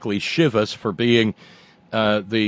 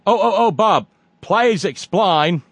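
higher, slow, hammed-up Australian accent; 9 semitones higher, 5 dB louder than usual. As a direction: drawl please explain like an Aussie. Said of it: "my bad Australian accent".

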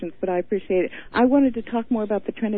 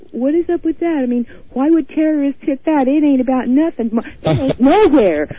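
louder, higher scoffing mock disbelief; mocking outsider; 6 semitones higher, 7 dB louder than usual. Caller mimics someone dismissing Minneapolis, mock scoffing. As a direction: imitate a dismissive outsider, hammed-up. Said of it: "I heard somebody say".

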